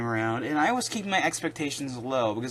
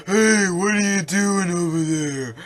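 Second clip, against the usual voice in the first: slow, goofy dopey voice, a bit louder; mocking Kuma's dub voice; 4 semitones higher, 5 dB louder, slower than usual. Mocking the English dub's Kuma, slow goofy dopey voice. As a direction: play him like a clueless idiot. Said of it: "That's literally what he sounds like".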